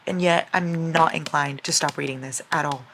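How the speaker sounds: ordinary delivery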